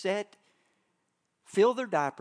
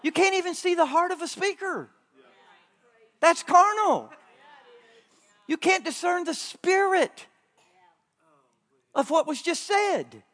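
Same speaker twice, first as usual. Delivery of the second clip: preaching at full force; 9 semitones higher, 6 dB louder than usual. Acted. Preacher pressing the point hard.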